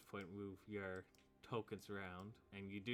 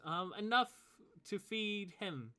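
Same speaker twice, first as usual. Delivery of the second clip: high, loud, slowing at the end; young woman's voice; 11 semitones higher, 8 dB louder than usual. Acted. Abigail ordering food for the lizardfolk, high and slow. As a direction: drag out the order. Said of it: "Abigail Tusher says".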